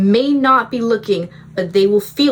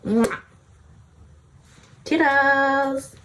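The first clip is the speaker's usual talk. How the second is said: higher, sing-song toodles; playful goodbye; 6 semitones higher, much slower than usual. Pretend. Future self says a chirpy goodbye to her past self. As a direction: wave off breezily.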